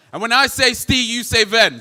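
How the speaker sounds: sing-song shouted call and response; hyped crowd warm up. Real, not acted. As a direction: chant it out to the crowd. Sets up call and response chant.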